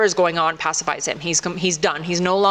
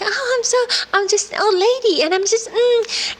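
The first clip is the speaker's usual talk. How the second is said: put-on high, quavery little old woman; old lady voice; 13 semitones higher than usual. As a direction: play a frail sweet old lady, pitched way up. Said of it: "she's like".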